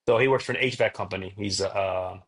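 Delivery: ordinary talk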